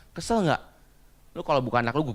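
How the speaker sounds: usual speaking voice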